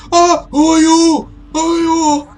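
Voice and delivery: high, loud, drawn-out screech; hysterical shrieking. Acted. Woman in bedroom seeing intruders return, hysterical shriek. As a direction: wail it in panic at the intruders.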